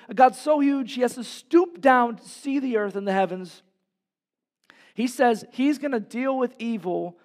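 high, loud, driving sermon voice; rising to a pulpit roar; fiery preaching. Acted. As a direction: preach it hard to the congregation. Preacher builds to full force.

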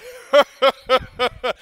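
hearty hammed-up belly laugh; imitated loud laugh. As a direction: laugh big like the loud guys in the aisle. Imitating big guys laughing loud.

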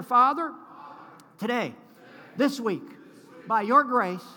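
loud congregational declaration in short phrases; bold declaration. Real, not acted. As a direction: lead the crowd phrase by phrase, full voice.

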